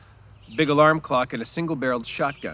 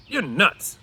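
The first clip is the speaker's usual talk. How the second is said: scoffing Crooks; 8 semitones higher, 6 dB louder than usual. A narrator's reading.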